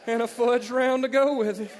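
loud, high preacher parody; pastor voice. Retold or imitated. Preacher at home asks wife for a snack, mock pastor voice. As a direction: intone it like a hammed-up pastor. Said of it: "does not talk that way".

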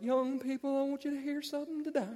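high, singsong preacher impression; mock preacher intoning to the congregation; pastor voice. Preacher on stage addresses young people, mock pastor voice. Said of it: "pastors that had pastor voice".

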